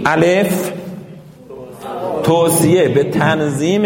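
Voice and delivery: loud, slow read-along; teacher leads a loud group reading; group reading aloud. Shown as a demonstration. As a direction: lead the class reading off the sheet. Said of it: "بالا این برگه رو بخونید".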